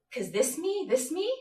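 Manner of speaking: soft, high rising repeats; doubtful questioning. Acted. Questioning who she has become, doubtful. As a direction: lift each phrase into an uncertain question.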